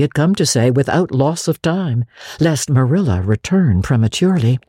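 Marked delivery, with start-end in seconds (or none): none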